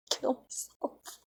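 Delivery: ordinary delivery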